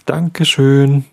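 high, drawn-out call back. Acted. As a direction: call back slowly and loudly. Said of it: "drehte er sich um und rief". (laut und gedehnt zurückgerufen)

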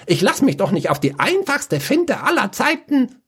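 high, scolding snap; shrill nagging. Acted. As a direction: bicker back scornfully. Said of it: "Der Schwarzmagier keifte".

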